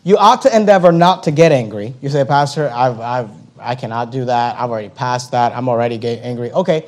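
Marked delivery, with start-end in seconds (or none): 2.2-6.5 low, soft voiced objection; objecting congregant. Congregant pleading inability to the pastor, low subdued objection. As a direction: drop low and quiet for the protest.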